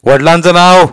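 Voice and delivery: loud, high demand; stern interrogator; police inspector. A narrator's reading. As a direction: fire the question at a child.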